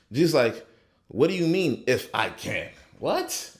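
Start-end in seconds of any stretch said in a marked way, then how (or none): 1.1-2.7 incredulous Jesus. Acted. Jesus answers doubting father. 3.0-3.5 sudden high-pitched what; a jumped-up disbelieving yelp; mock astonished what; incredulous squeak. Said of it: "Ain't no ifs with Jesus".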